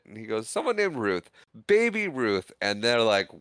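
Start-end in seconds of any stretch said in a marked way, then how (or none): 0.5-2.5 put-on high-pitched innocent kid voice; clueless kid answering. Kid telling teammates who signed it, high-pitched innocence. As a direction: pitch it up, unaware of the blunder.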